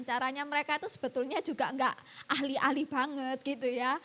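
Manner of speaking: ordinary talk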